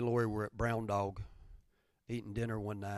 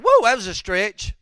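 mock whiny excuse; 10 semitones higher, 15 dB louder than usual. Mocking a reluctant helper.

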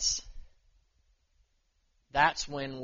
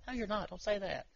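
higher, softer imitation of a wife; lightly voiced encouraging wife; 7 semitones higher, 6 dB quieter than usual. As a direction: gently imitate a wife's protest, pitched up and quiet. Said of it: "so she's my encourager".

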